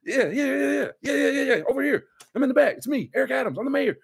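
high, insistent mock holler; hammed-up attention-grabbing call; loud waving over. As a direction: keep calling out from the back of the bar. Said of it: "he's doing it so loud".

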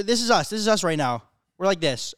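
ordinary talk, nothing marked

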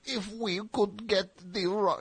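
put-on high, slow, botched Borat accent; abandoned attempt at an accent, Borat-like; bad Borat-like accent. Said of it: "it's a bad accent. I was thinking of Borat".

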